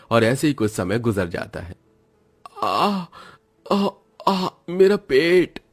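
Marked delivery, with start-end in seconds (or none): 2.6-5.6 slow, high pained moans clutching the stomach; drawn-out stomach-ache groans; groaning in pain. Acted. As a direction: moan like your belly is cramping.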